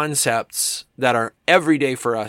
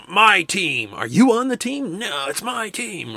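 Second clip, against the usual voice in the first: higher, emphatic fan claiming my team; possessive sports fan; 7 semitones higher than usual. Retold or imitated. Sports fan insisting on his team, mock proud. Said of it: "we're like".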